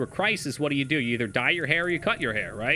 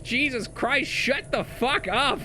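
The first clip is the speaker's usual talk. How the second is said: higher, slow exasperated telling-off; mock the roommate snapping at the noise; annoyed roommate; 9 semitones higher, slower than usual. Roommate in chat telling him to shut up, exasperated. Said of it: "He's like".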